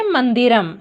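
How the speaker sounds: मंदिरम said with a clearly sounded final म, set apart from the sentence; careful teacher's मंदिरम, final म spoken in full; anusvara demo. Shown as a demonstration. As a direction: model मंदिरम for pupils, landing on the closing म. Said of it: "हम इस शब्द को बोलेंगे".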